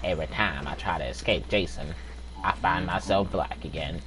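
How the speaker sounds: Morgan Freeman narration. Acted. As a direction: narrate like a calm documentary voice. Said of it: "Morgan Freeman back here".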